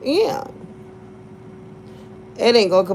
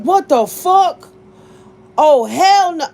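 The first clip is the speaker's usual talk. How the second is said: high, loud disbelieving outburst; outraged ex; 7 semitones higher, 7 dB louder, slower than usual. Retold or imitated. Ex sees daughter's photo, loud outraged disbelief. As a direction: blurt it out like a shocked father. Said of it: "He was like".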